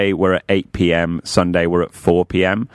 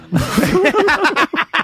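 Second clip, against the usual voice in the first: high burst of laughter. Real, not acted.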